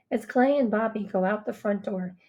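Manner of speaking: ordinary delivery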